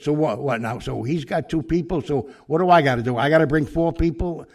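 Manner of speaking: rising indignation at the cops; hammed-up retold outrage; indignant challenge.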